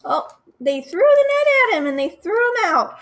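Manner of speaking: loud, high, thrilled telling; excited. Acted. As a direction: burst out with excitement for the kids. Excited narration of the climax.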